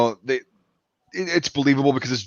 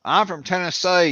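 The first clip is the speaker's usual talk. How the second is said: broad, higher-pitched Tennessee twang; mock Western Tennessee accent; 4 semitones higher than usual. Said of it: "'Cause Western Tennessee, yeah, they've got that".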